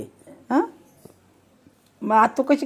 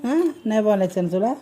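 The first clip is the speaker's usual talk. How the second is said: usual speaking voice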